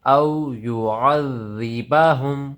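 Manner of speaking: very slow, drawn-out chant; Quranic recitation. Shown as a demonstration. Teacher demonstrating the joined word in slow recitation. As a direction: sing the word out long. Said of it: "ہا میم پیش ہم".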